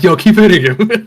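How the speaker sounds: egging someone on. Retold or imitated. Acting out Genzo egging her on.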